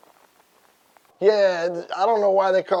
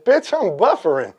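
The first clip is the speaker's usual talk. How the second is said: louder, higher snappy comeback; punchline retort; 4 semitones higher, 5 dB louder than usual. Acted. Boyfriend answering his girl in bed, raised retort.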